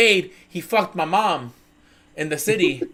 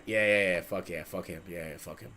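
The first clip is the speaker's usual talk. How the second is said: low, very soft, careless yeahs; low soft dismissive murmur about the client; mock muttering; 12 semitones lower, 14 dB quieter than usual. Lawyers muttering about Bobby.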